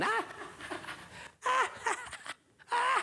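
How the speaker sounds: high, staccato bratty cackle; spaced bursts of kids' mocking laughter; put-on naughty getaway laugh; mischievous mock laughter. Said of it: "run away laughing".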